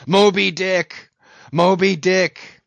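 slow, high, loud cry; drawn-out impassioned shout; shouting the name. A narrator's reading. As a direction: bellow the whale's name, stretching it out. Ahab crying the whale's name.

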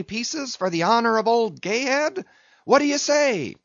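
high-pitched, fervent rallying call; rousing cry. A narrator's reading. Stubb challenging Tashtego and his men to pull, rousing cry. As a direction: fire up the crew, pitched high throughout. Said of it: "cried Stubb".

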